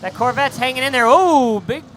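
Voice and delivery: loud, high-energy race call; excited play-by-play. Real, not acted. Live drift play-by-play.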